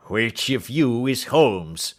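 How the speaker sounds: slow, loud, looming demand; menacing intruder. A narrator's reading. Roylott demanding which man is Holmes, slow and loud. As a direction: loom over them, slowly.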